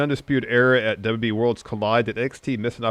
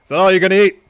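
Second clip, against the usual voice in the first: loud, higher brash wrestler voice barking orders; bully the cashier as the cocky champ; L A Knight; 9 semitones higher, 11 dB louder, faster than usual. Acted. Ordering at Del Taco, loud brash L A Knight impression. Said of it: "L A Knight's gonna take the lead".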